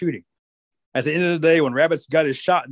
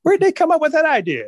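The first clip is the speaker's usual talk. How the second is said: put-on very high, squeaky child voice; imitated child's high-pitched wondering; little child; 13 semitones higher, 4 dB louder than usual. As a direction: pipe it up high, puzzled kid. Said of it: "little boy or girl's gonna say".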